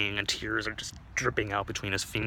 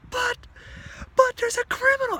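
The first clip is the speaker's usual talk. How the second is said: very high, louder sputtering plea; desperate protest; 6 dB louder than usual. Acted. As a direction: object in a panicked squeal.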